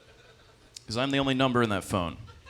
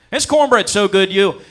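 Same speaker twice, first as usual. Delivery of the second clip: much louder and higher boast; proud brag, voice way up; 9 semitones higher, 11 dB louder than usual. Retold or imitated. Applicant defending his cornbread, loud high brag.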